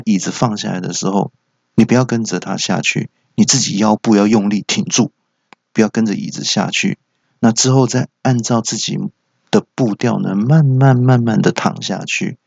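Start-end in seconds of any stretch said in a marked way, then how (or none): none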